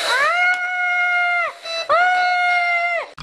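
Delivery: high play screams; pretend screams. Toys hit by the crane, pretend screaming. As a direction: scream as something falls on you.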